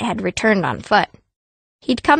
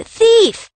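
much higher, louder mock yell of thief; retold scream; 11 semitones higher, 5 dB louder than usual. Street people yelling at a child beggar, a loud mock scream. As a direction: shout it like an angry householder. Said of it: "They scream".

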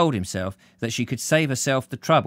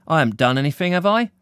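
lightly voiced man, rising anxious question; a hint of a worried young man; Percy, worried; 5 semitones higher, 5 dB louder, faster than usual. Percy asking the blonde if he hurt her, mild worry.

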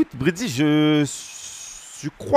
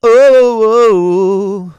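hype shout; 6 dB louder, much slower than usual. Pretend. Prediction announcement hype.

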